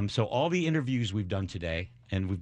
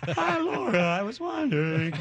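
put-on much higher, cracking teenage voice; Peter Brady voice crack; 13 semitones higher, 3 dB louder than usual. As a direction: squeak up like a breaking voice. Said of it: "my voice would crack".

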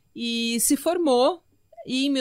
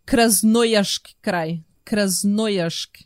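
slow, emphatic Russian accent on the place name; mock Russian accent; 3 semitones lower, 4 dB louder, much slower than usual. Shown as a demonstration. Host showing off her Russian accent for listeners, mock Russian accent. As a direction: lay on a deliberate Russian accent. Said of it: "preparem-se pro meu sotaque russo".

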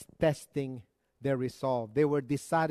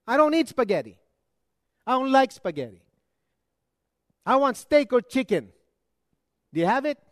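high, loud demanding voice; demanding homeless man; 7 semitones higher, 6 dB louder, faster than usual. Retold or imitated. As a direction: act out the picky guest asking for steak. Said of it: "and he says".

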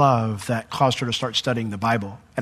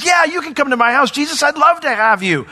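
much higher, loud, eager welcome; excited Zacchaeus; 13 semitones higher, 5 dB louder than usual. Retold or imitated. Zacchaeus inviting Jesus home, much higher and thrilled. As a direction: gush like a thrilled host. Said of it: "As Zacchaeus goes".

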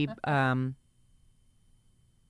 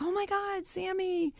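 high squealing fan voice; excited fangirl; 4 dB quieter, slower than usual. Retold or imitated. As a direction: gasp his name starstruck. Fans spotting [name].

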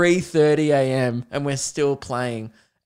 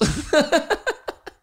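a squeaky laugh pitched way up; high squeaky laugh; 13 semitones higher than usual. Real, not acted. Laughing at his own silhouette joke, a high squeaky laugh.

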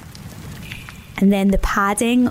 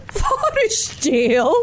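slow, laughing "Forest jail?"; laughing disbelief; 5 semitones higher, much slower than usual. Acted. Bear laughs at the idea.

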